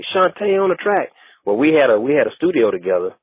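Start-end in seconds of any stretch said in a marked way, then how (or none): 0.0-1.1 much higher producer tag shout-out; Shantae. Retold or imitated. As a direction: imitate a producer's high-pitched track intro. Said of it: "he'd say".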